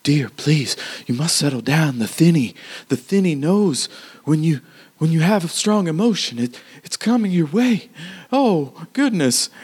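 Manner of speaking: put-on gentle old man. GM voices man calming woman.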